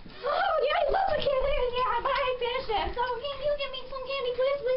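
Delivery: loud, high, gushing excitement; bubbling over, high-pitched begging; excited begging. Acted. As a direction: plead eagerly like a candy-crazed kid. Hyper Kate begs for candy.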